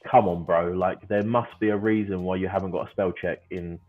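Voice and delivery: ordinary talk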